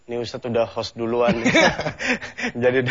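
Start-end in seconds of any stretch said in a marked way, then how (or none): 1.4-2.6 high-pitched burst of laughter. Real, not acted. Host amused that the Ustadz hosted first, laughing.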